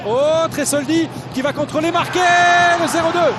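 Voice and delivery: normal talking voice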